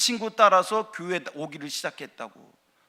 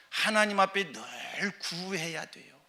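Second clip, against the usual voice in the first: normal talking voice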